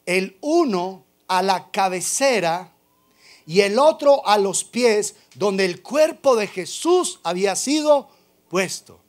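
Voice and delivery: loud, slow, emphatic scripture reading; preaching. Acted. Pastor proclaims the angels at the tomb, booming. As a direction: proclaim each word to the congregation.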